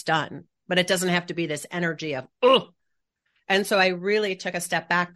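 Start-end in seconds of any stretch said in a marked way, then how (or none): 2.4-2.7 short strained "ugh"; imitated grunt of driven effort; effortful grunt. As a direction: grunt as if pushing hard.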